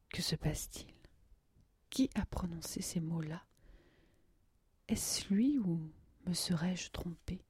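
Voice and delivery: whispered, wondering thoughts; hushed inner voice. A narrator's reading. Narrator voicing Nadia's puzzled thoughts, whispered. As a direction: breathe the questions to yourself.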